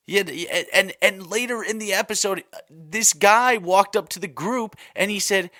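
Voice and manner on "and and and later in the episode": ordinary delivery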